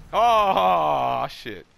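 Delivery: loud, drawn-out oh, falling away; real startled yell mid-game; startled outcry. Real, not acted. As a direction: cry out a long, alarmed oh. Going through the portal in game.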